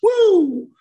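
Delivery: high, loud whoop of joy; excited whoop. Retold or imitated. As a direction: cheer like you just lit a fire. Cheering at little fire.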